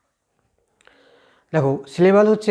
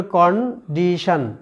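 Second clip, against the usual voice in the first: Condition slowly in three parts, KON, DI, SHON; condition with gaps between কন, ডি and শন; split into syllables; much slower than usual. Shown as a demonstration. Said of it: "তাহলে কন একটা অংশ".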